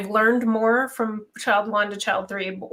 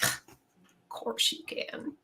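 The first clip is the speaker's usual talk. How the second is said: soft reply; 11 dB quieter, much slower than usual. Retold or imitated. Mom answering kids asking hugs.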